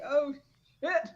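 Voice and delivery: high, loud startled oh shit. Retold or imitated. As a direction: caught out and panicking. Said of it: "be like".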